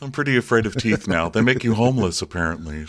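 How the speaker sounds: ordinary talk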